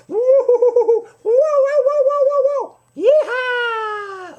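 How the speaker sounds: very high, loud cowboy holler; joyride whoop. Pretend. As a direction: whoop like a monkey on a runaway cart. George's joyride voiced for children.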